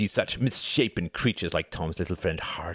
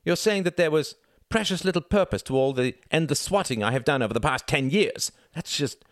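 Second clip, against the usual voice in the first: son railing at his father, louder and higher; indignant rising fury; 9 semitones higher, 4 dB louder than usual. Acted. Son berating his ruined father, loud and indignant. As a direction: pile on the outrage line by line. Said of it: "If you take that tone with me".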